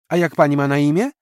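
put-on a kid asking back; small boy. Boy asks her name. (mały chłopiec, dużo wyżej, zaciekawiony)

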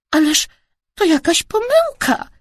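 high-pitched little protest; squeaked. A narrator's reading. Dad protesting to the family, squeaked. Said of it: "pisnął tata".

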